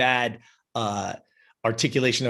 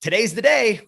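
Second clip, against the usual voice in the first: retold excitement, pitched higher; excited announcement; 8 semitones higher, 3 dB louder than usual. Client telling coach the day has come, excited. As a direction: imitate a client bursting with news.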